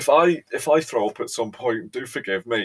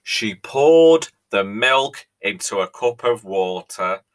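slow, louder, each word dragged out in disbelief; horrified telling; 8 dB louder, slower than usual. Acted. Host retelling a tea crime to viewers, slow dismay. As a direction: spell out the crime slowly.

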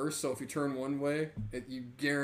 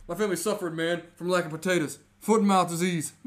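loud and raised, mock-earnest grievance; hammed-up sob story; 4 semitones higher, 8 dB louder than usual. Bernie Mac's character lying to the Irish guard, loud mock lament. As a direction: sell the fake hardship loudly. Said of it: "He's like".

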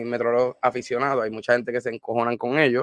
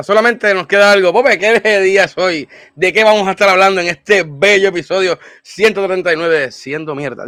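announcer voice; 5 semitones higher, 7 dB louder than usual. Acted. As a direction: build it up like a big reveal. Host announces episode topic.

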